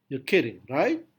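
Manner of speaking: right? lifted high at the end as a question; high rise on right. Shown as a demonstration. Teacher demonstrating a questioning rise on right. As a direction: swing right? sharply up, asking. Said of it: "kata right di sini mengalami intonasi yang tinggi".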